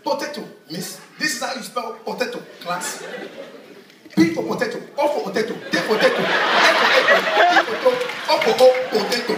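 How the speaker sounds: hammed-up African accent, dad drilling "potato" loud and high; dad's African-accented spelling lesson. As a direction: bellow the African-accented phonics drill like a classroom teacher. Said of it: "So my dad said".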